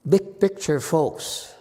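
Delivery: fervent sermon delivery; preaching at full force. Real, not acted. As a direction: urge the listeners from the pulpit. Preaching final message to crowd.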